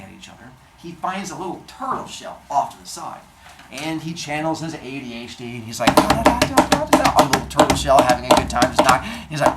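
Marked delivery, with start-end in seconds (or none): 5.9-7.2 loud, high rapid da-da-da; excited nonsense drumming noises; imitated drumming. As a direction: bang out a vocal drum roll. Hermes drumming on the shell.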